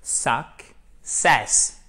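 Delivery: "Success" as "suk", pause, "sess"; syllable split demo. Shown as a demonstration. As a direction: break "Success" after the K. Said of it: "Vous avez le K qui est rattaché à la première syllabe". (« Success » en deux syllabes bien séparées)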